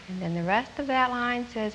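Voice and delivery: ordinary delivery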